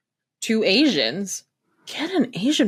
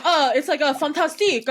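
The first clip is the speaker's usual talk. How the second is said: mock French accent; 7 semitones higher than usual. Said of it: "He's like".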